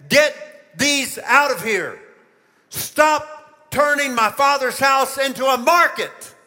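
slow, forceful order with long breaks between phrases; stern, drawn-out command; commanding rebuke. Acted. Acting out Jesus clearing temple.

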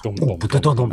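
rhythmic spoken drum thumps; imitated drum beat. Shown as a demonstration. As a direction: beat out the drums by mouth. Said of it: "the drums are like".

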